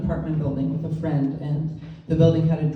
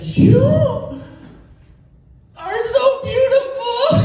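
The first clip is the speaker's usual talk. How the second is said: gushing compliment; 6 dB louder than usual. Retold or imitated. Woman grabs the comic's hand in line, gushing. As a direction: imitate an over-excited admirer.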